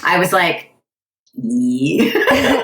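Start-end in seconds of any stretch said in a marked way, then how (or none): 1.3-2.6 put-on baby voice. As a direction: coo it like a baby. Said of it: "Your baby voice is".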